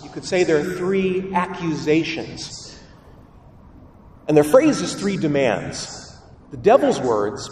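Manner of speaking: normal talking voice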